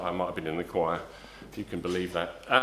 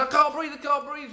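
put-on high, loud, panicky cry of a trapped boy; panicky plea; 12 semitones higher, 6 dB louder than usual. Boy shut in a dark cupboard calling out, panicky. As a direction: imitate a boy gasping in the dark. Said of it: "he started getting panicky".

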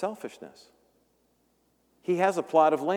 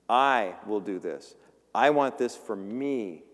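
slow selfish voice; 3 semitones lower, slower than usual. Acted. Voicing greedy self-focus.

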